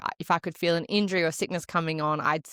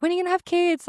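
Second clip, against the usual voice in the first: nagging in-laws; 13 semitones higher, 5 dB louder, faster than usual. Retold or imitated. In-laws nagging about kids.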